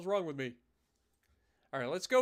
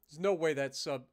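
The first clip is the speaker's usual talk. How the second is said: usual speaking voice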